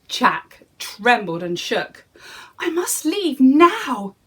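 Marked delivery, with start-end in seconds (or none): 2.6-4.2 nervous thought. Acted. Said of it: "he thought".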